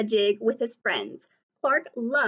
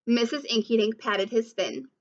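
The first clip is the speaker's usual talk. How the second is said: plain reading voice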